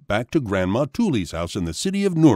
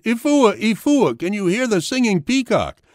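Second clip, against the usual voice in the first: high-pitched storybook cry; excited scream; 12 semitones higher than usual. A narrator's reading. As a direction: call out high to a sibling, mildly raised volume. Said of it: "stared at Ifua and screamed".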